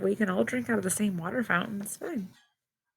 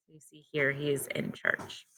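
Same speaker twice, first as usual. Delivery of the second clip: usual speaking voice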